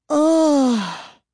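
high, loud disgusted ugh; disgusted groan. Acted. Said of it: "With a disgusted groan".

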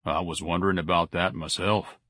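mildly vague, low-key reply; understated, offhand wondering. A narrator's reading. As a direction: muse it absently. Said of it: "Mister Zalatimo said vaguely".

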